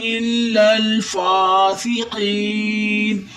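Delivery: slow, melodic tajweed chanting; measured sung recitation of the verse; Quran recitation. Acted. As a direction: draw each vowel out in a sung recitation.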